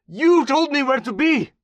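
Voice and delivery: high, loud frantic insisting; desperate shout. Acted. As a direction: scream it, begging to be believed. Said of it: "Mombasi repeated".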